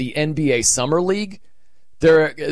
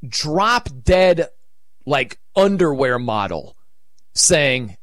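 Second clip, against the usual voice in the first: ordinary talk, nothing marked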